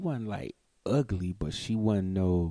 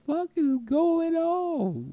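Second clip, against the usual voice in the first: high, slow, baffled outcry; stunned what-is-this; slower than usual. Retold or imitated. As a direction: act out your confusion, high and slow. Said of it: "I was like".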